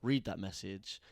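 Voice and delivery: ordinary talk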